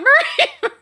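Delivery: high loud laugh into the name; giddy laughing. Real, not acted. Streamer laughing as her friend raids.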